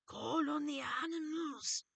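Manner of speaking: high, loud, slow beckoning voice; drawn-out, urgent creature call with stretched vowels; Dark Crystal creature. Retold or imitated. Short gray-haired creature urging Kira, high loud creature voice. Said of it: "she said".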